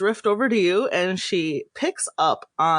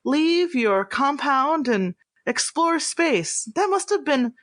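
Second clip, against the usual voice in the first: tender, lilting sympathy, rising higher as it goes; gracious celebrity lady; 5 semitones higher than usual. Acted. Idol consoling a rare Enar far from home, tender lilt. GM plays Zashawn.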